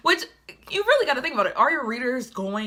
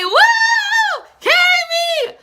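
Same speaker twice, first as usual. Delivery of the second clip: high, loud laughing whoop; drunk happy shout; 10 dB louder, much slower than usual. Retold or imitated. Vlogger reliving being carried by a friend, laughing shout. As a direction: yell it like you're being carried and loving it. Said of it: "I was like".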